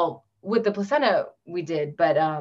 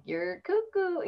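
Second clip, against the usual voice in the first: much higher and slow, teasing imitation of mom; drawn-out high mocking "you're cuckoo"; 11 semitones higher, 5 dB quieter, slower than usual. Imitating her doubtful mom during the pregnancy, high and slow.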